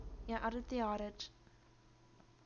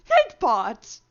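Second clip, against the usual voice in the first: much louder yell of the name; exasperated shout; 16 dB louder than usual. Real, not acted. Streamer yelling at the chat bot again. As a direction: call the bot out sharply.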